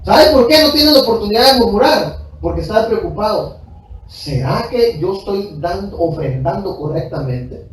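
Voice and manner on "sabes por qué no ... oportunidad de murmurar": fast, raised, pounding preacher voice; fervent, fast preaching climb; preaching at full force. Acted. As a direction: drive it home from the pulpit.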